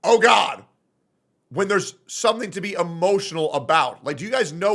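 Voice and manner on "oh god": mock exclamation. Shown as a demonstration. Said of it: "which means, like".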